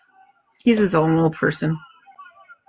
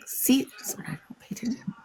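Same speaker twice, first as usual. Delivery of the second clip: much softer whisper to herself; whispered aside; 4 semitones higher, 11 dB quieter than usual. Real, not acted. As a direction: whisper the self-reproach under your breath. Self-conscious aside after missing message.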